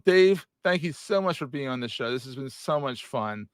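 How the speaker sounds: normal talking voice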